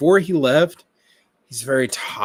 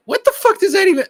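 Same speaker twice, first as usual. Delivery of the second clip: outraged shriek; 9 dB louder, faster than usual. Real, not acted. Outraged repeat of question.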